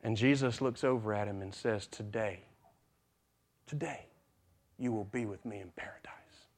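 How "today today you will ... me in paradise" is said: very soft and low, fading to a whisper; weak, barely audible reassurance; hushed promise. Acted. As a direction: promise it in a faint, dying hush. Dying Jesus answers the thief.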